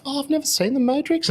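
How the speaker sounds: high, loud clueless voice; mock them, way up high and loud; young client. Young clients admitting they never saw it, high. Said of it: "where they're like".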